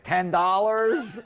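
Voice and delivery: put-on high, slow child voice, laughing; five-year-old son. Son put on the phone with mortgage broker, high childish guess. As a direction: answer like a little kid guessing. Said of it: "He's like".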